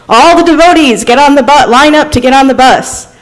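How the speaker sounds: mock public call-out; calling out to a group. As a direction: call everyone to line up. Retold man calling out to devotees.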